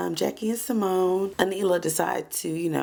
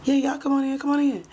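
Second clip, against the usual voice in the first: passive aggressive; 7 semitones higher, 4 dB louder, faster than usual. Retold or imitated. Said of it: "passive aggressive as ever".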